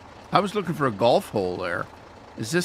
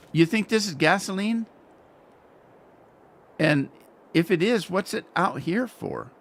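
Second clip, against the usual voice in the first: ordinary talk, nothing marked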